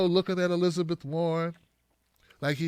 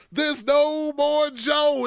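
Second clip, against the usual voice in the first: slow announcer call; 6 semitones higher, much slower than usual. Pretend. Repeated knockout call.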